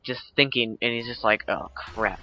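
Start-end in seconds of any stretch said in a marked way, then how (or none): 1.5-2.2 resigned mutter. Retold or imitated. Acting resigned realisation before crash.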